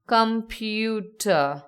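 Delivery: "computer" very slowly, com-pu-ter; syllable by syllable. Shown as a demonstration. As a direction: draw out each vowel sound in "computer". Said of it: "Three vowel sounds".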